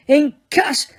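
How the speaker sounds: high roared spell. Acted. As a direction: roar the spell at him. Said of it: "Harry roared".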